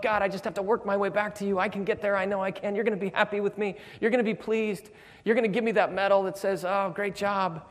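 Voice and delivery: a believer on a treadmill, pleading; anxious striver. Retold or imitated.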